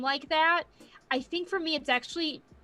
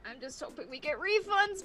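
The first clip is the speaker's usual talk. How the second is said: usual speaking voice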